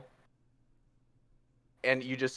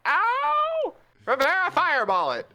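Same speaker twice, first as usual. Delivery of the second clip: very high yelp, then loud higher demand; hurt Puss shouting; 5 dB louder than usual. Acted. Puss stubbing his paw, yelping at Rivera. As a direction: cry out then order her. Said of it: "he's like".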